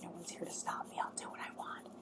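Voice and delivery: defiant little whisper to oneself; hushed, voiceless aside, far under normal volume. Real, not acted. Solo vlogger reassuring themselves no one can stop them, whispered aside.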